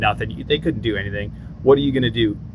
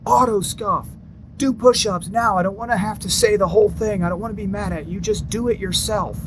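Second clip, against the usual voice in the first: mock drill sergeant; 9 semitones higher than usual. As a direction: whine the orders like a tired instructor. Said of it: "And he's like".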